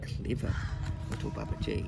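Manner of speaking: soft, near-whispered praise; whispered admiration. A narrator's reading. Reader admiring boy's clever escape, whispered. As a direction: breathe it out like a shared secret.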